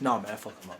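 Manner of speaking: low, soft, teeth-clenched friend voice; through bitten teeth. Retold or imitated. Imitating his friend Perry through clenched teeth. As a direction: bite the words through your teeth. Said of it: "he, like, bites his teeth".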